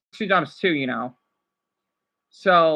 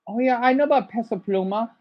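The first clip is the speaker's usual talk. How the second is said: usual speaking voice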